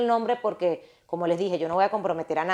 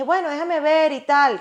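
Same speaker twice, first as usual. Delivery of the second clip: flustered; 8 semitones higher, 5 dB louder than usual. Retold or imitated. Her flustered reply quoted.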